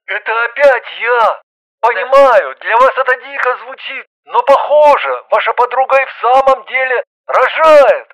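high, loud, desperate male caller; distressed plea over the phone; pleading. A narrator's reading. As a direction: beg her urgently to believe. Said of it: "Мужчина взмолился".

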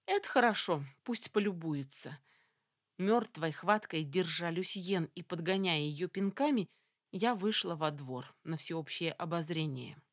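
ordinary delivery

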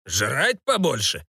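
медленно, растянутое недоумение барона; переспроси врастяжку, сдвинув брови; недовольный барон. A narrator's reading. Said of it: "сдвинул брови барон". (недовольно, врастяжку)

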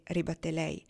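ordinary delivery, nothing marked

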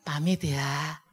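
soft, low Javanese leave-taking; hushed, courteous Javanese manner; Javanese politeness. Shown as a demonstration. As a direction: excuse yourself gently like a Javanese. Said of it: "Orang Jawa".

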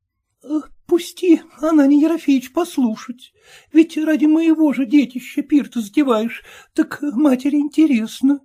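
high pleading wife's voice; timid merchant's wife pleading, voiced high. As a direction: beg the husband to let her listen, pitched high. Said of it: "тихо проговорила она".

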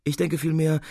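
ordinary delivery, nothing marked

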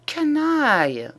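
drawn-out, high-pitched indignant growl; growled through teeth. A narrator's reading. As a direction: curse him slowly through gritted teeth. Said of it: "gronda le bonhomme entre ses dents". (juron grondé entre les dents)

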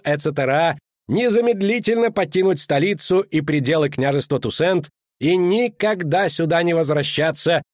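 official proclamation. Acted. Official reading the princess's decree aloud, formal proclamation. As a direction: declaim it to the square.

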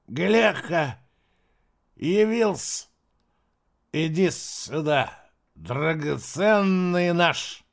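put-on slurred, slow drunken drawl; blackout drunk. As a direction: slur it like a staggering drunk. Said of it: "еле ворочая языком и шатаясь".

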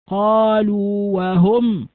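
قالوا وهم recited very slowly, the long waw held before وهم; slow recitation example. Shown as a demonstration. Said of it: "البعض يقول". (تلاوة قالوا وهم ببطء دون إدغام)

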